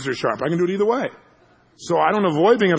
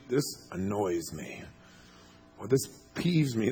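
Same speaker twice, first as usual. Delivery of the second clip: slow, soft, lower, carefully tried-out words; understated annoyance; 6 semitones lower, 8 dB quieter, slower than usual. Pretend. Imagining milder words when upset, understated. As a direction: test the mild word on yourself, hushed.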